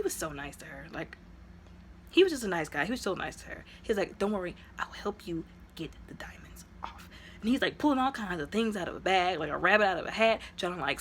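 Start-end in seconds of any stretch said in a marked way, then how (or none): 4.2-7.1 whispered, gentle reassuring quote; calm her down softly, almost whispered; soft reassurance. Retold or imitated. Nice brother calms the captive.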